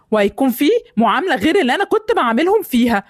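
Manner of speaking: ordinary talk, nothing marked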